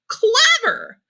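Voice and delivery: very high, loud incredulous "Clever?"; surprised cry. A narrator's reading. Old man astonished at the word, a high indignant cry. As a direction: scoff in amazement. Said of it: "cried the old man".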